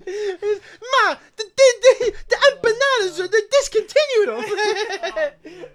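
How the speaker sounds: loud, high Italian-guy yell breaking into laughter; classic Italian guy. Retold or imitated. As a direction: stammer and bellow like an exaggerated Italian caller. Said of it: "sounds like classic Italian guy".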